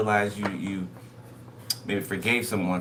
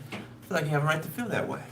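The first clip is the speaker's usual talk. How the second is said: quicker repeat; 7 semitones higher, faster than usual. Shown as a demonstration.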